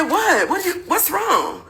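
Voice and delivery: high-pitched, bewildered questioning; retold wide-eyed confusion; confused. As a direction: ask it baffled, slowing down. I'm confused like a mug.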